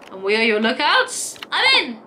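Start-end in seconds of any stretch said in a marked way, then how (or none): 1.5-2.0 eager wombat. Acted. Wombat character agrees.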